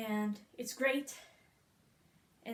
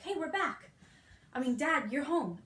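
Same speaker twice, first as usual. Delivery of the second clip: put-on startled kids; 5 semitones higher, 4 dB louder than usual. Kids caught by the captain.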